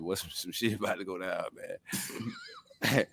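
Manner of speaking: low hushed warning; hushed aside. Retold or imitated. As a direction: murmur it under your breath. Quiet aside to P I.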